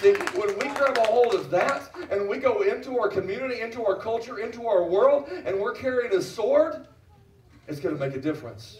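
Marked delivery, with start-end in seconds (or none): 0.0-6.9 higher, driving call to action; rallying preacher. Real, not acted. Preacher recruiting volunteers as warriors, fervent rallying call. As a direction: rouse the crowd, pressing harder phrase by phrase.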